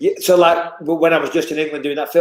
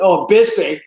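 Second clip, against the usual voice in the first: higher-pitched mock American fighter, indignant; American accent; 5 semitones higher than usual. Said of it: "that American accent that crept in there".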